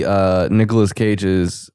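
ordinary delivery

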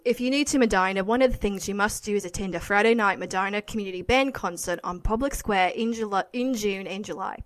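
local paper read in an Australian accent; attempted Australian accent, reading aloud. Shown as a demonstration. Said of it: "try to read something and try to do Australian".

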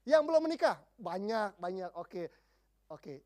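usual speaking voice

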